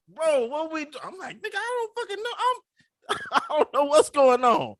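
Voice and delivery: a mock-frantic plea, voice cracking up; playing up his own freak-out; panicked disbelief. Retelling his panic at the firing.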